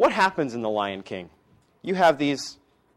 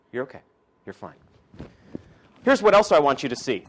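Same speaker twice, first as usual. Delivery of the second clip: normal talking voice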